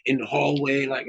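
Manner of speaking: normal talking voice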